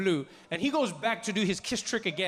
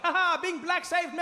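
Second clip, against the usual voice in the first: gloating; 9 semitones higher than usual. Retold or imitated. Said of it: "I was like".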